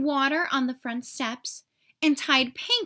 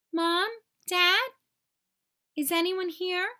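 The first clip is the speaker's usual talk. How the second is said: hopeful calling; 6 semitones higher than usual. Acted. Kevin searching for family.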